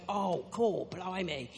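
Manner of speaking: mock South African take on English accents; funny South African accent copying British TV; South African-accented English slang. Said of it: "in our funny South African accents".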